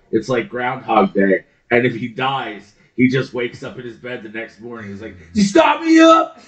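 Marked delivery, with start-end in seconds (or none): 5.2-6.4 high, yelped rock-frontman impression; hammed-up rock star waking straight into a stage shout; mock rock-singer holler. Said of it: "[name] is in fact immortal".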